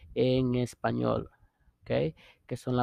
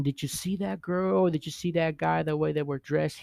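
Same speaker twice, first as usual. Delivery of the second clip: higher, pretend gossipy questioning; mock gossip; 4 semitones higher than usual. Friends texting about someone's outfit, acted gossip. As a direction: play a friend passing on gossip.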